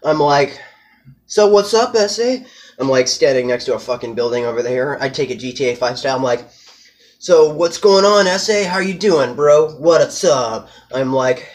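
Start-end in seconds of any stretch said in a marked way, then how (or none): 1.3-2.4 high, loud mock gangster call-out; tough guy challenge. Rival calls out the trespasser, mock tough. Acted street confrontation quote. 7.2-10.7 higher, louder mock homie greeting; cocky greeting. Narrator greets the rival, swaggering and loud.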